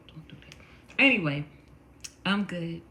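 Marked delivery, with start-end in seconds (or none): 0.1-0.5 whispered don't do that. Real, not acted. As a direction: hush it out teasingly. Teasing the yawning viewer.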